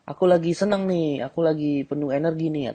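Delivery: girl saying she's happy, lightly voiced upbeat; mock happy girl. Shown as a demonstration. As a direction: sound pleased with yourself. Girl full of energy.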